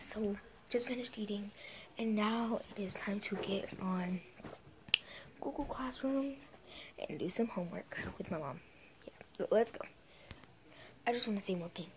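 soft unvoiced whisper. Real, not acted. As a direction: hush it so nobody hears. Still whispering, people home.

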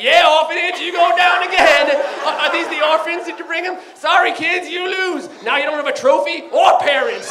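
high, loud, gloating shouts; obnoxious trash talk. Retold or imitated. Jerk player shouting trash talk at the orphans, loud and gloating. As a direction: taunt the kids like a jerk. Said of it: "like a complete dick".

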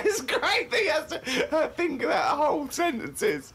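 words pushed out through high laughter; laughing. Real, not acted. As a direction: laugh while you try to talk. Laughing at the diary.

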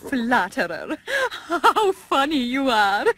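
giggling coquettish diva; flattered laughing. Acted. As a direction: laugh through the words, flattered.

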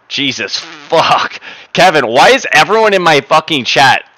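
loud, slow fed-up curse; exasperated outburst. Real, not acted. Streamer venting at chat about his sound, loud exasperation.

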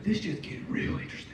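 watching a couple fight, whispered aside. Retold or imitated. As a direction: say it under your breath, amused. Aside muttered during fight.

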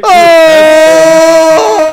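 loud high cry through megaphone; megaphone scream. Real, not acted. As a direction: howl into the megaphone. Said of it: "used a megaphone and screamed into it".

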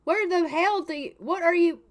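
high, loud shocked yell; shouting in disbelief at the intruder; outraged shout. Real, not acted. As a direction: blurt it out in horror. Finds naked realtor in house.

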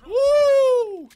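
high, loud victory whoop; triumphant whoop. Real, not acted. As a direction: let out a real cheer of relief. Escapes an enemy in game.

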